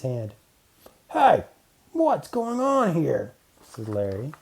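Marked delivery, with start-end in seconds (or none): 1.1-3.3 high, loud bully voice; bully's challenge. Acted. Bully Larry challenges the group, high and loud. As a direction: confront them, demanding.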